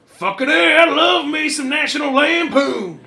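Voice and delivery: high, loud put-on dude voice; drunk guy voice. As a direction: whoop it like a rowdy moviegoer. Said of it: "He says".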